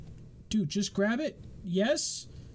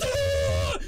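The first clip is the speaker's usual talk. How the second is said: mock scream; 5 dB louder than usual. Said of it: "And the bitch goes".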